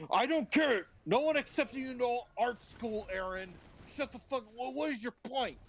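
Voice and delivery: impatient Moses. Acted. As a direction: cut him off, fed up.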